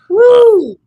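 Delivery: loud, high cheer; a sudden loud celebratory woo; excited whoop. Real, not acted. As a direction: let out a big whoop. Cheering out in the studio.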